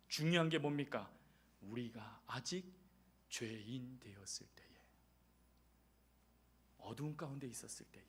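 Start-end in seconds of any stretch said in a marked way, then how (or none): 1.6-8.0 hushed, soft whisper. Real, not acted. Preacher whispering of our darkness to the congregation. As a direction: breathe it out quietly.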